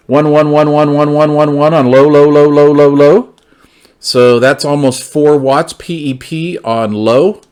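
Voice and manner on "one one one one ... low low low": loud, steady count into the mic; radio test count. Real, not acted. Reviewer counting into the handheld for a power test, loud and steady. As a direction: call the numbers out to key the transmitter.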